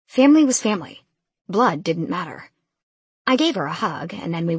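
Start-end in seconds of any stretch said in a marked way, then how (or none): none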